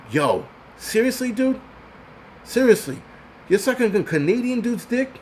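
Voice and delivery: high, stunned and incredulous; can't believe what he's hearing; incredulous disbelief. Retold or imitated. Mocking a viewer who praises a rival YouTuber, incredulous. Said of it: "I'm like".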